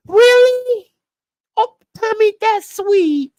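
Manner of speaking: high falsetto woman's voice, gushing mockery; falsetto Tasha mock. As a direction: parody her cooing back at him. Mocking Tasha's reply to Tommy.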